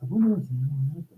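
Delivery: ordinary talk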